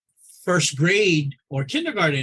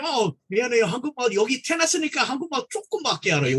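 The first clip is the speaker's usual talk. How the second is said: rushed apology; 7 semitones higher, much faster than usual. Retold or imitated. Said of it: "I tell them".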